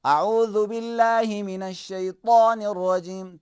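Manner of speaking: slow recitation of اعوذ باللہ; Quranic recitation. Shown as a demonstration. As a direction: recite it slowly, with measured weight. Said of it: "recitation demo".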